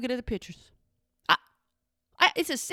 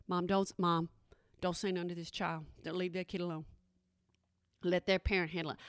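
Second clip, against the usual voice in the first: soft, low begging murmur; hushed pleading kids; 5 semitones lower, 7 dB quieter than usual. Retold or imitated. Her nervous kids, softly begging mom to stay out of it. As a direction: quietly beg mom not to interfere. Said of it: "They give me the side eye like".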